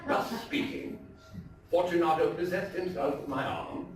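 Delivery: dramatic, theatrical storytelling voice; story narration. A narrator's reading. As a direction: read it like a gothic tale told by the fireside. Dramatic reading of Poe story.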